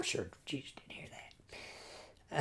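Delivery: slow, soft whispered aside. Real, not acted. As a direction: whisper it slowly like a private joke. Amused aside about Nathaniel's insult.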